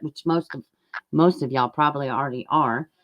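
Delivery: ordinary talk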